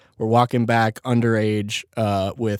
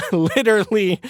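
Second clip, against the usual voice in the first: laughing disbelief; 9 semitones higher than usual. Real, not acted. Laughs at the lucky crash.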